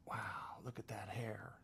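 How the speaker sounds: unvoiced hushed whisper of gawking shoppers; whispered stage aside in the grocery aisle. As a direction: breathe it like a stunned onlooker. Staring strangers, whispered.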